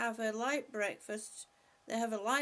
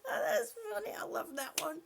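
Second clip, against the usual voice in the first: laughing through the words; laughing while talking; real laughter; 8 semitones higher than usual. Real, not acted. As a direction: giggle at the comment. Laughing at a chat comment.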